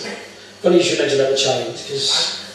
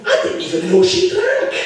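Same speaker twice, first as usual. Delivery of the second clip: hammed-up Scottish accent; 2 semitones higher than usual. Scotsman telling the barman about his daughter, Scottish accent. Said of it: "The Scotsman says".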